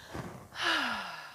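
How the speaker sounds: long slow relaxing exhale; deep calming sigh. Shown as a demonstration. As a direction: breathe out slowly to calm the dog. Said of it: "I'm gonna take a deep breath".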